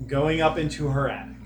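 measured scene-setting storyteller voice; game master narration. Acted. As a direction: set the scene like a GM.

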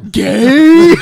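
very loud, high drawn-out jeer; mock heckle. Host jeering at the explained catchphrase, loud and teasing. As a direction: belt the one word out as a heckle.

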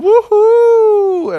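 very high excited whoop; whooping audience cheer. Acted. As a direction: cheer like a crowd. Crowd cheer.

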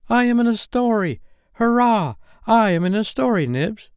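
loud, very high-pitched cheer; overjoyed. Acted. Toodles telling Nibs he's in the story, overjoyed. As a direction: exult like a thrilled child.

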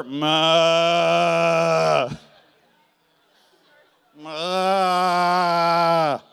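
loud, long repeated bellowing moos; cow moo. Acted. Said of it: "Jacob wakes up and he looks over".

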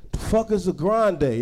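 usual speaking voice

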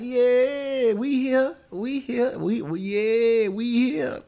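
high, drawn-out imitation of horns; imitating the Mellows. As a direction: mimic the mellophones, stretching the notes. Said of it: "The Mellows was like".

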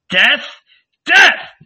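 high, loud shout, rising on the repeat; escalating crowd chant; mob cry. A narrator's reading. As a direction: yell for blood. Mob cries for death.

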